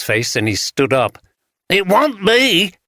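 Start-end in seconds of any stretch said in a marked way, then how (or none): 1.7-2.7 put-on sudden high alarmed cry in a Yorkshire accent; alarmed outburst. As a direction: blurt it out, startled and scared. Tanner alarmed, stands up.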